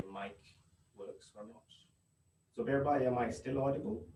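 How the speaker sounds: faint, faraway mic voice; barely audible. Real, not acted. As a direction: voice barely coming through. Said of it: "but your voice is very low".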